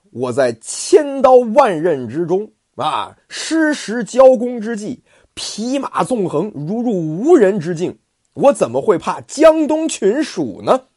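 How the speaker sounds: 高亢洪亮、放慢的武将口吻; 像关公一样傲气十足地拍板; 关二爷傲然. Acted. 关羽夸耀勇武. (关羽对儿子关平豪言，高亢傲然)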